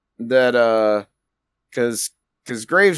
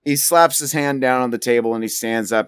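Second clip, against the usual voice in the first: normal talking voice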